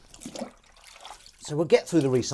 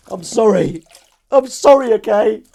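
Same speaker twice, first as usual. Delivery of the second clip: much higher, louder squeaky voice; squeaky pet apology; 11 semitones higher, 18 dB louder than usual. Acted. Squealing sorry to Crumb the dog, high and loud.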